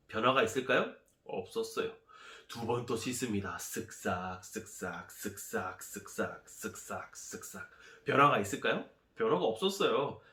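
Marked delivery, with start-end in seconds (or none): 3.6-7.7 low, soft, rhythmic chant fading away; hushed scrubbing sounds. Acted. General washing in the Jordan, hushed rhythmic scrubbing. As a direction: scrub in rhythm, dropping to near whisper.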